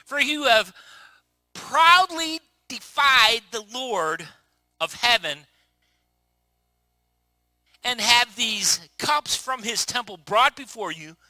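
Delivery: loud, slow preaching; forceful preaching. Real, not acted. Preacher reading the verse to the congregation, loud and slow. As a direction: hammer each word of the verse.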